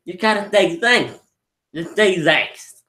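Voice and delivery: lightly acted encouragement, slowing down; coaxing demonstration. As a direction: imitate patiently teaching someone a word. Coaching Broly on saying thanks.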